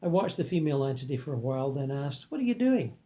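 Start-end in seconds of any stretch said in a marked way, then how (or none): 2.3-3.0 questioning. Acted. Narrator voices himself asking.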